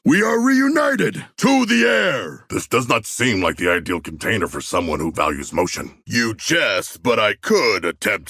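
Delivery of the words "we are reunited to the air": joyful shout. Acted. King Shark cheering as he heads into the air, elated shout. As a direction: roar it out in delight at the reunion. Reunion shout before taking flight.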